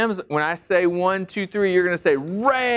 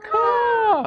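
high screeching caws; eagle caw; much slower than usual. Shown as a demonstration. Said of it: "They go".

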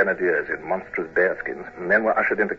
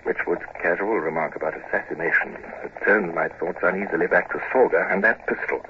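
ordinary talk, nothing marked

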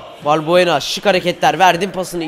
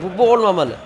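ordinary talk

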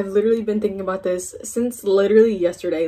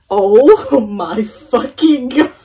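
slow, louder, stretched-out outburst; overwhelmed; 3 semitones higher, 7 dB louder, much slower than usual. Real, not acted. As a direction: drag it out, overwhelmed. Overwhelmed by the song.

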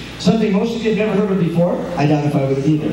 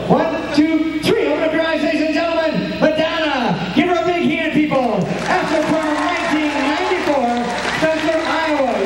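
showman announcer; 7 semitones higher than usual. Acted. Hypnotist announces [name] to crowd.

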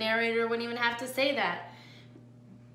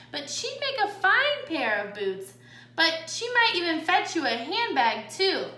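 high, louder sassy armadillo voice; sassy middle sister; 5 semitones higher, 5 dB louder than usual. A narrator's reading. Jilly tells off the mangy coyote, high and sassy. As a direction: scold up high with sass.

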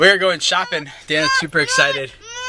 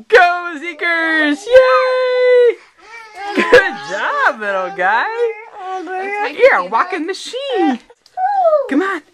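very high, loud cheers; excited cheering; 4 dB louder than usual. Real, not acted. Cheering baby walking again.

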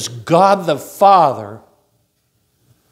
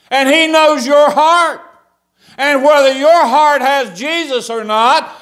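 fired-up preaching; 10 semitones higher, 4 dB louder than usual. Acted. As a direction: preach it out to the congregation. Preaching, pitch and level raised.

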